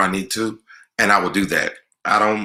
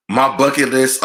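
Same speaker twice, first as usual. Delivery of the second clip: excited echo; 6 semitones higher, 4 dB louder than usual. Real, not acted. Echoing the question with delight.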